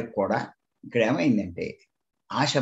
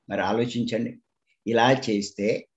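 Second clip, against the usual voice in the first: ordinary talk, nothing marked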